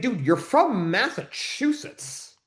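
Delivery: drawn-out, high-pitched disbelief; incredulous. Retold or imitated. Host confronting the coach over his fake Southern accent, incredulous. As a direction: call him out, stretching the words in disbelief.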